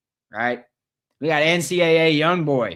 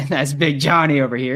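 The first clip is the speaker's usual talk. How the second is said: louder, laughing through "That's Big Johnny over here"; 2 semitones higher, 8 dB louder than usual. Real, not acted. Host cracking up at his own joke about the coach, laughing. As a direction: let the laugh spill into the line. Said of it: "That's, that's Big Johnny over here".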